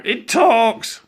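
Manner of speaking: loud, high shriek of alarm; screamed. A narrator's reading. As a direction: scream it out in astonishment. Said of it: "screamed Jemima".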